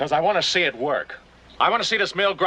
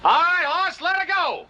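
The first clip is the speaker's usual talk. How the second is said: shouted signal, much higher; calling out; 11 semitones higher, slower than usual. Acted. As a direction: call the signal from afar. Calling across to brother.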